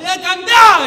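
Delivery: high, very loud mock order; hammed-up demanding shout; fan yelling in your face. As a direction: bellow it like a rowdy fan. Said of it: "a gritar en la cara".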